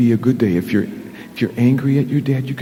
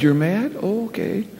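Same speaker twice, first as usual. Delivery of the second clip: put-on higher, sing-song voice for a child; puppet chat; 6 semitones higher than usual. Pastor coaxing the puppet Big Al, higher and sing-song. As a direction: coax a sulky little kid to talk.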